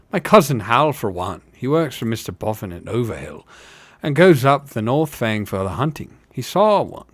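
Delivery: rustic hobbit vouching for a tale; lilting village gossip with punched emphasis; insistent working hobbit. Acted. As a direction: insist like a country fellow.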